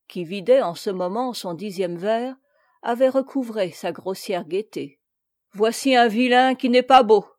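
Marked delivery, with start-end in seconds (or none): 5.5-7.3 loud, jovial mockery without laughing; hearty exclamation. A narrator's reading. Tipsy captain mocking the peasant, loud hearty exclamation. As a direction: exclaim it loudly, as a coarse jest. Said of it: "s'écria-t-il en éclatant de rire".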